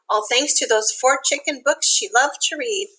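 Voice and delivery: ordinary delivery, nothing marked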